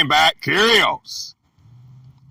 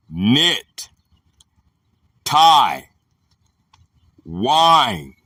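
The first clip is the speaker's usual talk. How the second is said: slow, spaced reading with long pauses; dictionary read-out; slower than usual. Acted. Teacher reading a word definition to listeners, measured read-out. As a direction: read each item separately and deliberately.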